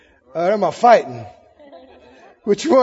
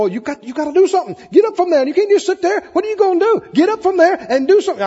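high, urgent, pestering devil; nagging devil; 6 semitones higher, faster than usual. Acted. Devil pushing a resting believer to act, high urgent nagging. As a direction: badger them in a raised, hurried voice. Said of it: "the devil will come".

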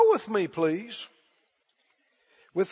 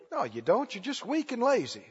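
normal talking voice

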